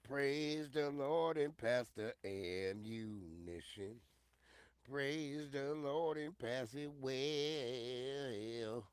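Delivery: slow, drawn-out mock hymn line; slow sing-song recital. Streamer reciting an old saying to his viewers, slow sing-song. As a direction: stretch every word like a church refrain.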